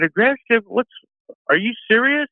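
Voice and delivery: high, incredulous retort; mock indignant disbelief. Caller retelling his retort to a rude shopper, high mock disbelief. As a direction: throw her word back at her, high and stunned. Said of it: "And I said".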